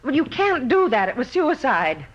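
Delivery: high, loud desperate plea; frantic pleading. Acted. A woman begging police not to arrest Ross, frantic and loud. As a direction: cry out to stop the arrest, begging.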